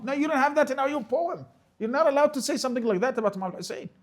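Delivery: very high-pitched, heated scolding; agitated, high-pitched telling-off; indignant rebuke. Acted. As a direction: cut down the objection, pitch right up. Rebuking an imagined poem reciter.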